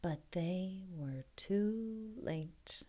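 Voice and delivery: slow whispered reveal; soft unvoiced storyteller. A narrator's reading. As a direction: hush them for the ending. Suspenseful story ending.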